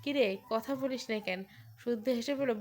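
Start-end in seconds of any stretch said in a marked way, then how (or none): none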